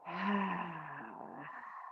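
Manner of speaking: lengthened sigh, frustration easing; long sighing exhale. Shown as a demonstration. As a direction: stretch the exhale out. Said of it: "Let it go longer".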